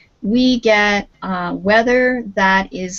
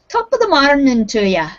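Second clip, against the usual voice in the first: higher, lilting Irish greeting; Irish accent; 6 semitones higher, 4 dB louder than usual. Shown as a demonstration. Teacher imitates an Irish greeting, higher Irish lilt. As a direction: put on an Irish accent, pitched up. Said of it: "the accent that they use".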